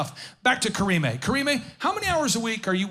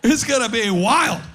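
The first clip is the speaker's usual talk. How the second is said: loud, laughing through the words; laughing at his own joke; 4 semitones higher, 6 dB louder than usual. Real, not acted. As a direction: crack up mid-sentence.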